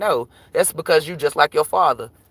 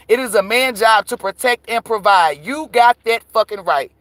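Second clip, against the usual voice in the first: ordinary delivery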